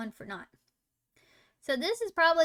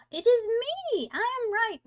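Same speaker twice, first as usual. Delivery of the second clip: gleeful high-pitched victory yell; triumphant shout; 11 semitones higher, 6 dB louder than usual. Real, not acted. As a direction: crow loudly that you were right. Gloating she guessed right.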